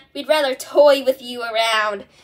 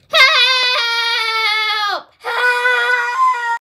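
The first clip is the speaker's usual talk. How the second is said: high, loud, desperate cry; yelling for help; 10 semitones higher, 4 dB louder than usual. Acted. Tied to a chair by a cliff, yelling for help. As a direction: scream so someone finds you.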